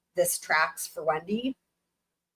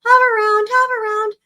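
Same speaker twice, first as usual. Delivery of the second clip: much higher, louder cheesy ad jingle voice; Hoveround commercial; 12 semitones higher, 6 dB louder than usual. Retold or imitated. Mocking the old Hoveround commercials, high loud ad voice. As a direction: sell it like an old TV ad, high and bright-eyed loud. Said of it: "Like Hoveround?".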